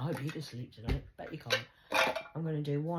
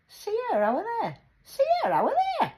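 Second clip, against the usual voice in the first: Geordie accent, shouted much higher and louder; Geordie call; 12 dB louder than usual. Shown as a demonstration. Calling Sierra over there, loud Geordie shout. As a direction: call it out across the street in Geordie. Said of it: "In a Geordie language".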